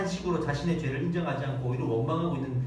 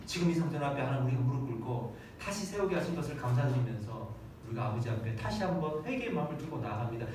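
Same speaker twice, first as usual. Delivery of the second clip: much softer, lower penitent prayer; humble prayer; 6 semitones lower, 6 dB quieter than usual. Shown as a demonstration. Preacher modelling a humble prayer of repentance to God, soft and low. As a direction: pray it quietly, bowed in repentance.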